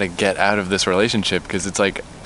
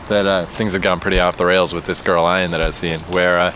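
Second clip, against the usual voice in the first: ordinary delivery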